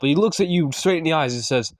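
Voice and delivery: ordinary delivery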